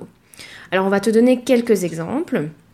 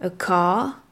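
British English; 5 dB quieter than usual. Shown as a demonstration. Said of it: "tu entends bien la différence".

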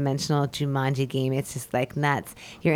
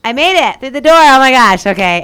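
bursting out high and loud with joyful relief; breakthrough relief; 8 semitones higher, 12 dB louder than usual. Retold or imitated. Breaking through a hard moment, excited shout of relief. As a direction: cry out like you just made it through.

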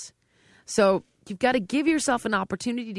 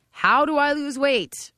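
high, loud and slow plea; anxious question; 7 semitones higher, 5 dB louder, slower than usual. Retold or imitated. A dieter begging a trainer for answers, raised and slow. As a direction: ask it like a helpless beginner. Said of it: "for example".

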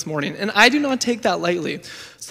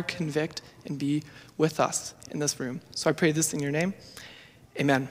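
low, soft praying voice; hushed, lowered voice addressed to God; earnest prayer; 4 semitones lower, 6 dB quieter than usual. Real, not acted. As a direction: pray quietly, phrase by phrase with pauses. Leads an opening prayer.

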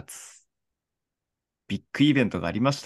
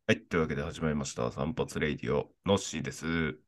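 lower, softer show opener; radio host intro; 6 semitones lower, 7 dB quieter than usual. Acted. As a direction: announce the show casually. Show opening announcer read.

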